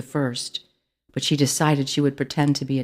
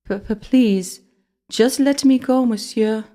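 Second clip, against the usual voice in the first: high, loud stuttering begging; stammering terrified plea; 8 semitones higher, 5 dB louder, slower than usual. A narrator's reading. Ellie begs the rat, high stammering fear. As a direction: beg with fear choking the words.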